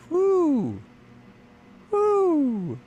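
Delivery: falsetto woo; high whoop. Real, not acted.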